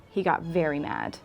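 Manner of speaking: usual speaking voice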